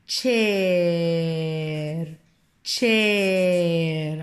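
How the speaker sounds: تشير drawn out in one long glide; blended slowly. Shown as a demonstration. Teacher blends the sounds for the class, slowly drawn out. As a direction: stretch the vowel of تشير right out. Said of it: "على بعضيها".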